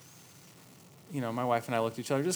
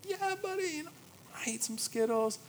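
put-on sweet reply to toddler; 13 semitones higher, 3 dB quieter than usual. Dad answering toddler sweetly.

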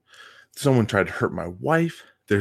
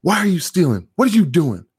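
higher and louder stern demand; confronting shout; 9 semitones higher, 6 dB louder than usual. Retold or imitated. Teacher catching a kid stealing lunch, stern higher shout. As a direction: loom over the kid and demand answers. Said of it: "He goes".

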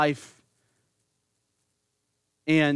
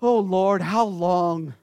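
hammed-up whining child over chores; mock the sulky sigh of a kid; mock kid whine; 3 dB louder, slower than usual. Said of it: "When mom or dad tell you".

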